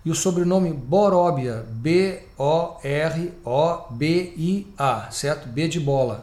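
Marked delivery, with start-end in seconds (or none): 1.7-5.1 letters spelled out slowly, one by one; slow spelling. Shown as a demonstration. Radio host spelling the guest's surname for listeners, slow and deliberate. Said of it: "eu vou repetir de novo".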